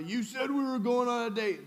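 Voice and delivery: mock a pushy date grabbing her; pushy demand. Acting out an aggressive boyfriend.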